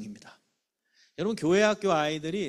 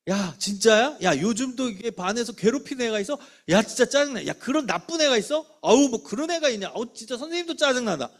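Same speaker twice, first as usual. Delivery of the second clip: put-on louder, higher, indignant on the kid's side; shared annoyance; 6 semitones higher, 6 dB louder than usual. Shown as a demonstration. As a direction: get fired up with the child. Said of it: "똑같이 짜증을 내주는 거예요". (아이 편에서 함께 짜증 내는 목소리로)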